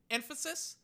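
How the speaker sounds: high, rising disbelief; incredulous question. Real, not acted. Streamer questioning the new league rule, incredulous. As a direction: throw the word back as a disbelieving question. Incredulous echo of the rule's name.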